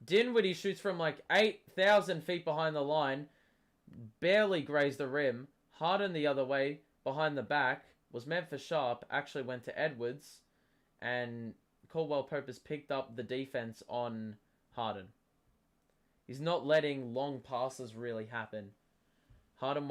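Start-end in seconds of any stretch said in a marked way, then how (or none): none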